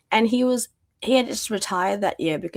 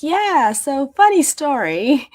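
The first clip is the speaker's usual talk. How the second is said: sheepish confession; 7 semitones higher, 7 dB louder, slower than usual. Retold or imitated. Sheepish reply to husband's shock.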